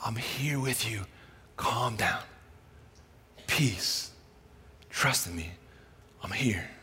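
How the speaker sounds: soft, hushed comfort from Jesus; reassuring whisper. Acted. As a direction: whisper peace into the storm. Jesus voiced reassuring amid storm.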